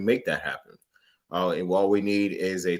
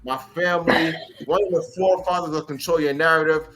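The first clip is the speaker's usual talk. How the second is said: ringmaster; 6 semitones higher than usual. Acted. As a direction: ring announcer introduction. Introduces special guest.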